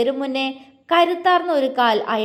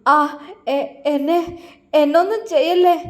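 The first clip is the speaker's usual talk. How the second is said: fearful plea; 4 semitones higher than usual. A narrator's reading. Cornered villain begging the swordswoman, panicked and higher. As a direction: beg for mercy, terrified and rising. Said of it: "അയാൾ പേടിയോടെ പറഞ്ഞു".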